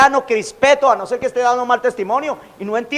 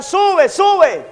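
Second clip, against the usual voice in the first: high jeering call of sube; mocking taunt; 7 semitones higher than usual. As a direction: tease like kids on a hill. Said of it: "Y le decían". (burla aguda de muchachos)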